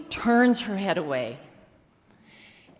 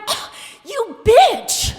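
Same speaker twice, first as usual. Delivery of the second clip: high, loud, slow indignant shout; hammed-up huff of wounded pride; the inner critic squawks in outrage at being judged; outraged inner perfectionist; 13 semitones higher, 7 dB louder, much slower than usual. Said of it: "perfectionist in me was kind of like".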